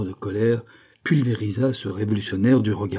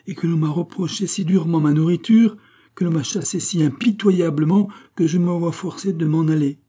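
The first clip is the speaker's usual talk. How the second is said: loud, high, pathos-laden public plea; stentor pathétique; 7 semitones higher, 5 dB louder than usual. A narrator's reading. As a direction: rouse the neighbours with your injured outcry. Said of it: "Pitou mit assez de pathétique". (plainte publique pathétique, aiguë et forte)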